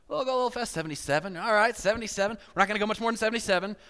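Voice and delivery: hammed-up thrill of going fast; mock excited speeder. Driver egging himself on, mock excited and rushing. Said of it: "and you're like".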